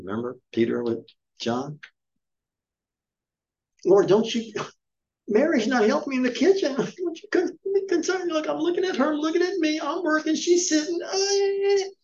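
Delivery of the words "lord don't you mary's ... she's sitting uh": very high, teary, whining falsetto; Martha's whine. Retold or imitated. Martha complaining to the Lord about Mary, high teary whine. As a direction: squeal it out like a tattling sister near tears.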